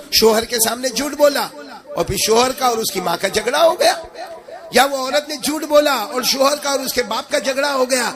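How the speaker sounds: high, amused listing of petty lies; mocking. Preacher mocking lying wives to the crowd, amused. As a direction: tease the gossip with a laugh in it.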